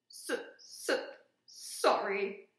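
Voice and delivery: choked, stuttering apology; stammering sob. Acted. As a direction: sob out a broken sorry. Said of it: "sobbed Hagrid".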